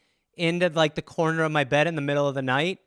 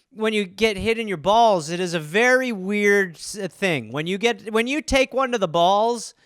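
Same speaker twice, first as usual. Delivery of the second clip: ordinary talk, nothing marked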